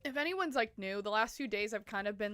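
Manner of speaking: ordinary delivery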